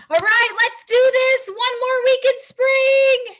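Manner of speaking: high, loud and cheering; excited. Real, not acted. Cheering the group on.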